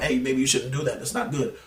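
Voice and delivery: a limp, half-hearted caution from a butler; timid butler voice. Retold or imitated. Mocking a weak Alfred warning Batman.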